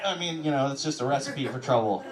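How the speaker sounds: ordinary delivery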